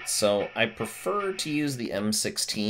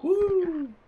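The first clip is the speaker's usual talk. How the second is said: high falsetto whoop; shrieking jackpot winner. Real, not acted. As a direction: let out a shrill victory woo. Whoops after the fight.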